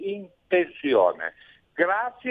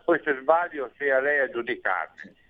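ordinary delivery

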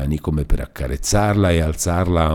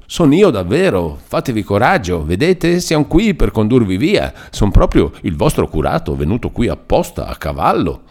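high, sing-song insistent encouragement; eager reassurance; 5 semitones higher, 4 dB louder than usual. A narrator's reading. Priest convincing the captive he came to take her away, eagerly reassuring. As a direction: reassure with fussy, swooping emphasis.

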